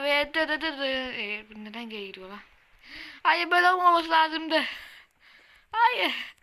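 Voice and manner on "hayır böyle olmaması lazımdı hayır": raised, exasperated no; frustrated protest. Real, not acted. Gamer yelling at a broken build, frustrated. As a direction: protest loudly as the build fails. Game going wrong, protesting.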